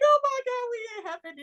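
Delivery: put-on high, thrilled little-kid squeal; wide-eyed childish awe; excited child gasp. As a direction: gasp it out like a four-year-old seeing heaven. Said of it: "And I'm like".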